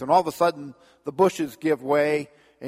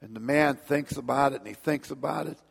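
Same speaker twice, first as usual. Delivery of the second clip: usual speaking voice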